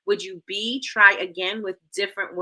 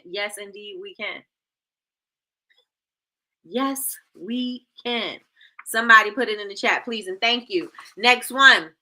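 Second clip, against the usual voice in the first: ordinary talk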